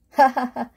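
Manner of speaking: big open-hearted laugh; hearty laugh. Acted. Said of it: "너털 웃음을 터뜨렸어요".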